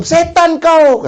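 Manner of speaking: high, slow furious insult at his wife; mock angry shout. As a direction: drag out the insult like a man losing his temper. Suami bertengkar memaki istrinya. (teriakan marah pura-pura, tinggi dan lambat)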